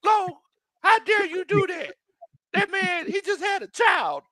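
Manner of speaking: high, fast whiny outrage voice; mock outraged fan. Imitating fans scolding Big Lo, high whiny outrage. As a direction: whine like a scandalized fan, way up high. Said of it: "People like".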